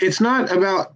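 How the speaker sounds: ordinary talk, nothing marked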